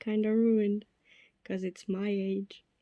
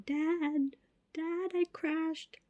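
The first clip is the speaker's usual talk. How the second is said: mock panicked cry; 9 semitones higher, much slower than usual. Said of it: "started crying, yelling".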